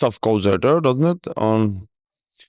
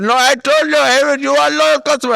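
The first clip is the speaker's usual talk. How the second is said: much higher and louder mock scammer yell; hammed-up shriek of a phone scammer losing patience; screeching scam caller; 12 semitones higher, 5 dB louder than usual. Scammer yelling back at the caller, hammed-up high shout. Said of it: "She said".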